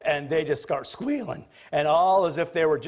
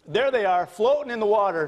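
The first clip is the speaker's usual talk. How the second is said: normal talking voice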